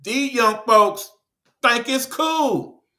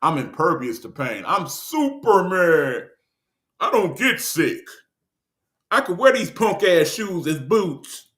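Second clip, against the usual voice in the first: put-on cocky teenager; 5 semitones lower than usual. Mocking seventeen-year-olds who feel like Superman, cocky. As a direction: lower mock know-it-all teen. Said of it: "you feel like".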